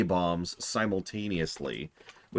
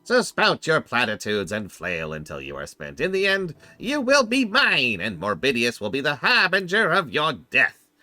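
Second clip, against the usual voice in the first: cold rasping evil emperor; 11 semitones higher, 7 dB louder than usual. Acted. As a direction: roar it out like an undead tyrant king. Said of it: "I'll give the Lich King his appropriate voice".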